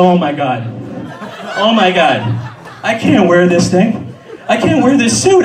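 panicked. Retold or imitated. Panicked realisation, laughing.

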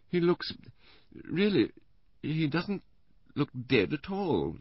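high, halting invalid voice; Missus Bland, faltering. A narrator's reading. Invalid wife studying the dead man's photograph, faltering. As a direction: falter delicately, with long pauses.

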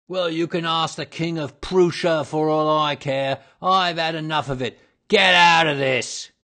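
high shout building to a bellow; furious shout. A narrator's reading. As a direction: explode and chase them off. Salesman chases inquirer off.

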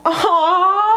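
high teary aww, half laughing; a choked, high aww at the card; moved high aww. Real, not acted. Reader moved by a love card, teary high aww. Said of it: "I'm gonna cry".

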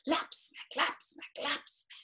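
soft whispered lap and smack; whispered waves. A narrator's reading. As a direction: breathe out the lapping waves. Wave sounds whispered.

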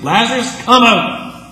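high, loud proclamation; commanding call. Retold or imitated. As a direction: command him out of the tomb. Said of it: "these two famous phrases".

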